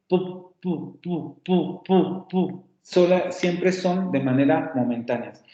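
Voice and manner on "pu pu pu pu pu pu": short "Pu" pops, held short; "Pu" as quick plosive bursts; demoed p sound. Shown as a demonstration. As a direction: show "Pu" cannot be sustained. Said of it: "No podemos mantener en el tiempo una p".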